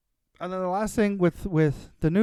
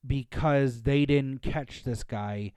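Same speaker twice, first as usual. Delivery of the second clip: ordinary delivery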